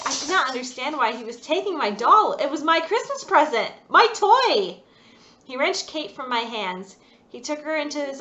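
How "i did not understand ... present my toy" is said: tearful protest. A narrator's reading. Girl crying, protesting.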